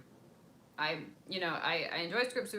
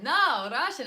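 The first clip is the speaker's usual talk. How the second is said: mock locals' reply; 9 semitones higher, 11 dB louder, slower than usual. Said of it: "And they were like".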